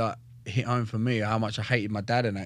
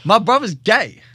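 loud insistent repeat; 8 semitones higher, 9 dB louder, slower than usual. Retold or imitated. Repeating the news to his mate, loud and insistent. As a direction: shout it so he finally hears. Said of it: "you're not hearing me".

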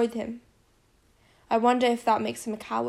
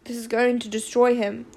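ordinary delivery

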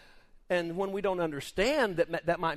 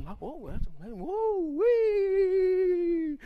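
excited whoops; 12 semitones higher, 6 dB louder, much slower than usual. Pretend. Acting out Danny praising God.

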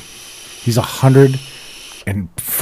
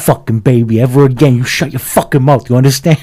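menacing growl, then louder; angry uncle; 3 dB louder than usual. Retold or imitated. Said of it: "And he goes".

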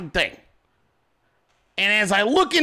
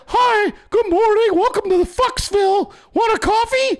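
very high falsetto; hammed-up feminine greeter; mock girly voice; 12 semitones higher, 4 dB louder than usual. Shown as a demonstration. Showing a greeting that would pass, high mock girly voice. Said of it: "any of that would pass".

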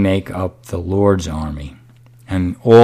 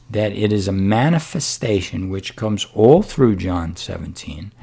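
ordinary talk

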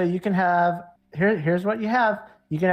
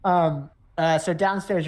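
normal talking voice

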